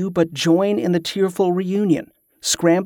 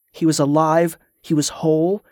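ordinary delivery, nothing marked

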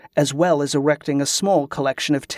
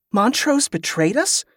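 higher, startled question; 7 semitones higher than usual. A narrator's reading. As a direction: ask it in rising disbelief. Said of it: "Kerla was so startled".

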